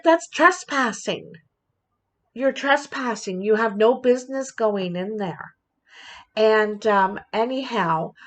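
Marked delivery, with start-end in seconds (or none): 0.0-5.5 mock-stern neighbor confronting a trespasser; retold scolding. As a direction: tell him off, then calm down. Retelling telling off a trespasser.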